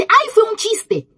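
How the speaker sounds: high, slow, mocking sing-song; a sneering impression of her excuse; mocking whiny quote. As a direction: mimic her brushing it off as a joke. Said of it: "Ella dice".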